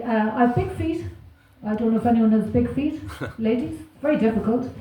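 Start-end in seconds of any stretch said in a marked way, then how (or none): none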